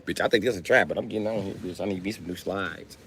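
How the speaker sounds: ordinary delivery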